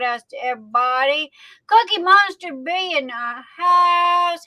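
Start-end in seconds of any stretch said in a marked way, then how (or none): 1.7-4.4 excited shout-out. Real, not acted. Livestream host hypes a viewer's arrival.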